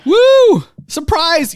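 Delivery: loud high whoop; excited. Pretend. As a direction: cheer out the surprise. Announcing surprise episode.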